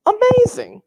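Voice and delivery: amazing squealed up high and loud; a gleeful, way-up-high burst of wonder; amazed. Real, not acted. Teacher telling students about Jackie Robinson, squealing in amazement.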